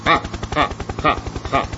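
slow, separated "ha" laughs; theatrical, spaced-out laugh; merry guffaw. A narrator's reading. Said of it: "весело расхохотался".

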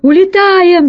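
high, loud cry of retreat; acted frantic yell; panicked shout. A narrator's reading. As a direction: shout it out in alarm. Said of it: "закричал крыс".

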